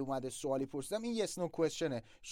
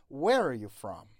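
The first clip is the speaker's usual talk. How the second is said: "where" punched loud, "are you" slipped in unstressed; stress on where and from; 2 semitones lower, 5 dB louder, much slower than usual. Shown as a demonstration. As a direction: lean on "where" and "from", glide over "are you".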